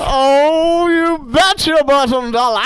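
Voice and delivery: mock yelling. Co-host yelling at listeners for a joke, hammed-up shout. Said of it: "Stop yelling at our listeners".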